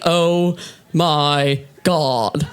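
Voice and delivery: low, very slow, unexcited drone; deadpan freak-out. Retold or imitated. Comic freaking out in her own voice, deadpan. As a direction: spell out the shock slowly with no excitement. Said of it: "I freak out. I'm like".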